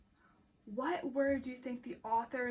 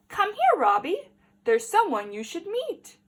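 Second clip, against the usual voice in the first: louder, upbeat calling dad; cheerful dad; 8 semitones higher, 11 dB louder than usual. Acted. Dad calling Robbie to meet the robot, louder and upbeat. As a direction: call your son over proudly.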